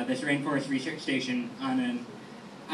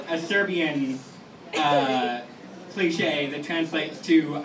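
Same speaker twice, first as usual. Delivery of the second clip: ordinary talk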